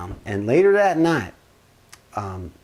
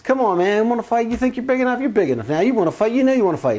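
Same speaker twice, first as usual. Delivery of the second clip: higher, goading retold voice; taunting challenge; 7 semitones higher, faster than usual. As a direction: imitate a brother egging on a fight. Brother taunts him into a fight.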